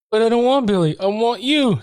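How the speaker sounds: put-on high, pleading girlish plea. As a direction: squeak it out high and begging. Pleading quote in retelling.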